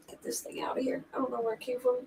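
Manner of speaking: hushed disgusted whisper; talking to herself about the tick in a whisper. Real, not acted. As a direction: breathe it out under her breath.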